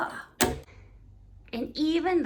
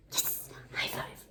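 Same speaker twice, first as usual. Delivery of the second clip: a hushed celebration; whispered cheer; 13 semitones lower, 13 dB quieter than usual. Acted. Men celebrate secretly.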